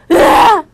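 short loud, high mock cry; high-pitched burst of fake crying; mock wail. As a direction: burst out in a brief pretend wail. Mimes crying after "cry.

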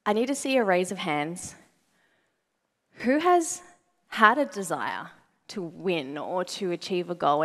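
usual speaking voice

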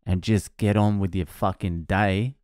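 usual speaking voice

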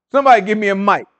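raising the voice to demand a mic. Real, not acted.

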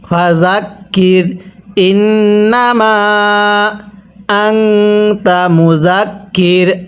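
slow chant, ইন্নামা stretched long and আংতা hummed through the nose; measured model recitation with long মা in ইন্নামা, nasal আংতা; madd and ghunnah. Shown as a demonstration. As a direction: hold the end of ইন্নামা, then the ng of আংতা. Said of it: "তিন আলিফ টেনে পড়তে হয়".

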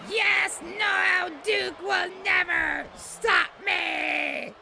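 high-pitched villain's victory cry; Cobra Commander. Acted. Streamer imagining his victory speech, high cutting villain voice. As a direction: screech it high, drawing out the end. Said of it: "He always has that high-pitched voice".

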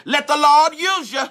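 high, loud encouragement; congregant call-out. Retold or imitated. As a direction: cheer the preacher on. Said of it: "They might say".

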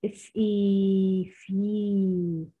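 stretched letter sounds اف اي then في; sounding out. Shown as a demonstration. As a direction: blend the sounds slowly into في. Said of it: "sounding out letter sounds".

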